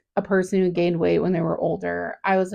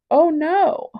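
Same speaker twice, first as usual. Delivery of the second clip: high, louder voice breaking into tears; real crying; 9 semitones higher, 5 dB louder than usual. Real, not acted. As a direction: let the cry come out. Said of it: "I gotta get the cry out".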